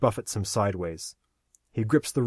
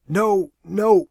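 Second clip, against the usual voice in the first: loud, high wail; anguished cry; 10 semitones higher, 8 dB louder than usual. Acted. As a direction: cry out in despair. Aaron cries as Lori vanishes.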